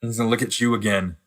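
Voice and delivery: ordinary talk, nothing marked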